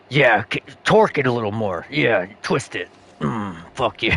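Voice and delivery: a low, hushed mock tough guy; creepy fighter voice. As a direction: purr it like he enjoys the pain. Imitating fighter egging on pain.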